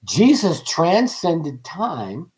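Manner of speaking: very slow, weighty delivery; slowly stated. Shown as a demonstration. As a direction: let each word land slowly. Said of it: "You can actually say it like this".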